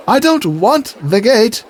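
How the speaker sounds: high, slow, put-upon knight; exasperated knight. Retold or imitated. Knight correcting the guard, mock exasperated. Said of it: "the skit goes something like this".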